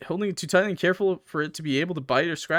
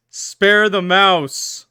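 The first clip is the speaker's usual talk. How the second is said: very slow and louder, every word punched; defiant declaration; 3 semitones higher, 9 dB louder, much slower than usual. Shown as a demonstration. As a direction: stand firm and hammer the choice out. Game pushes option, defiant reading.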